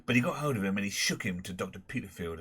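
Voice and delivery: plain reading voice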